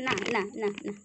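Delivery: normal talking voice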